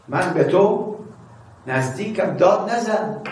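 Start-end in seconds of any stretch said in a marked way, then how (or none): none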